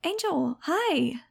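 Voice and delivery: put-on a happy girl waving hello; cheerful girl greeting. A narrator's reading.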